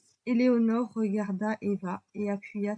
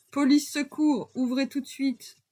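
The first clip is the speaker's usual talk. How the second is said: appel d'homme fort et lent; voix puissante; 5 semitones higher, 5 dB louder than usual. A narrator's reading. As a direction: tonner à travers la porte. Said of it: "Une voix d'homme puissante retentit". (policier qui tonne à la porte, fort et lent)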